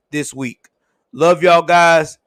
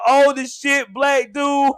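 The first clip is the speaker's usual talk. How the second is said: ordinary delivery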